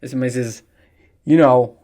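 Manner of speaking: ordinary delivery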